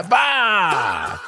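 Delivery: short high shout; playful bark. Pretend. Joke burst after laughing.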